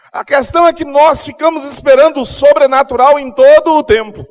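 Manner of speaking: loud, high, fervent preacher's call; preaching at full force. Acted. Pastor rousing the congregation about miracles, full-force preaching.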